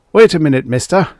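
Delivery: loud call after a stranger; yelling. Acted. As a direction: shout to stop him on the stairs. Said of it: "he yelled".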